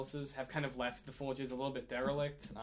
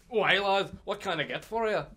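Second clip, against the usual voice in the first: louder, higher Australian-style shopkeeper greeting; dwarf shopkeeper; 6 semitones higher, 8 dB louder than usual. Acted. Dwarf shopkeeper greets a new customer, hearty role voice. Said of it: "and the shopkeep goes".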